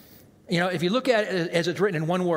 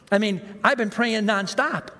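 ordinary delivery

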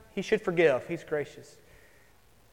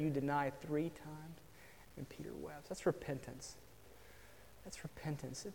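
hushed sermon; 3 semitones lower, 13 dB quieter than usual. Acted. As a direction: let the words fall almost silent with grief. Sermon hush over Peter's tears.

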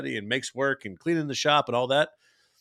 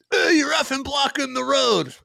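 high, drawn-out mock yell; cranky; 12 semitones higher, slower than usual. Neighbor pulling up on the truck, cranky mock yell. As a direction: imitate a cranky driver hollering. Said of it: "he was cranky".

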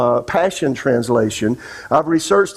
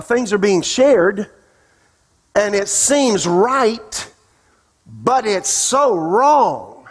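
higher, slow, drawn-out sermon voice; fervent preaching; 5 semitones higher than usual. Acted. Pastor warning the congregation about deceiving spirits, fervent and slow. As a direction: press each phrase slowly like a warning from the pulpit.

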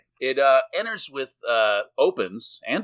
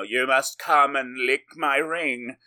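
slow, put-on lordly command; hammed-up young lord voice, a bit higher; haughty lord; 4 semitones higher, 3 dB louder, slower than usual. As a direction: order them grandly, drawing it out. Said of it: "now he's like the Lord".

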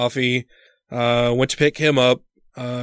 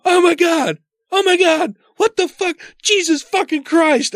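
panicked, much higher shrieking; freaking out. Retold or imitated. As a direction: squeal in disgusted panic. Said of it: "I started freaking out".

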